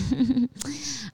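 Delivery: high, light giggle; short real laugh, high-pitched; self-deprecating laugh. Real, not acted. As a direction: giggle at your own anxiety. Laughs after admitting anxiety.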